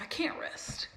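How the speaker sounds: whispered, repeated to herself; whispered inner voice. Retold or imitated. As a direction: whisper it like a restless thought. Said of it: "And I was like".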